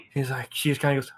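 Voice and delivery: ordinary talk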